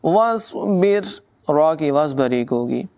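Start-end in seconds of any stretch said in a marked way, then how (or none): 0.1-1.0 drawn-out recited word; Quran recitation. Shown as a demonstration. Teacher recites the word for students, slow chant. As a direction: recite it slowly like a tilawat.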